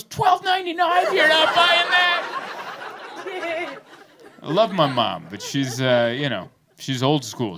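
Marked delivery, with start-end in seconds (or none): 0.0-3.8 high, slow, squealing disbelief; mother aghast at the rental price; shocked mom. Retold or imitated.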